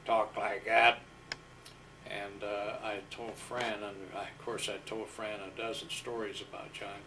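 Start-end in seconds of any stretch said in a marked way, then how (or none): 0.1-1.0 gravel voice. Retold or imitated. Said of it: "he had a very gravel type voice".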